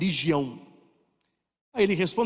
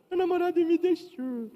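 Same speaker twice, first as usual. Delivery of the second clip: mock crying girl; 11 semitones higher than usual. Mocking a girl crying over boyfriend.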